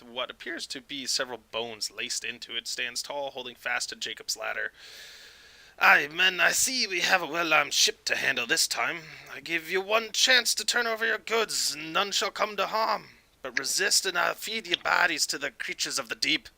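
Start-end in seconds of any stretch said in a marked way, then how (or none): 5.8-16.5 put-on raised Scottish-accented pirate voice; high, projected Scottish captain's ultimatum. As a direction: threaten them in a put-on Scottish brogue. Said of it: "Why are you Scottish?".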